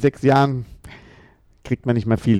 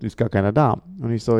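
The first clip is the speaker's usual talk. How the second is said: ordinary delivery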